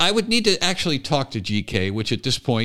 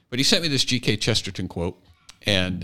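usual speaking voice

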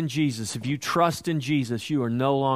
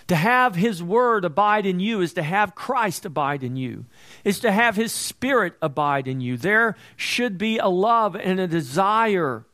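raised, louder preaching voice, pushing each phrase; fervent preaching; 6 semitones higher, 4 dB louder than usual. Acted. Preacher urging believers, in full-force sermon voice. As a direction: preach it out to the congregation with fire.

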